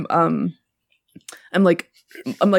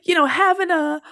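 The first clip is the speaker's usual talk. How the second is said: raised, breezy fake small talk; mock casual chatter; 7 semitones higher than usual. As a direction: pretend to chat casually. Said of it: "as one does to indicate a private, quiet conversation".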